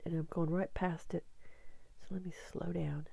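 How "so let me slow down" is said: quick whispered aside. Real, not acted. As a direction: murmur it while busy searching. Muttering while scrolling.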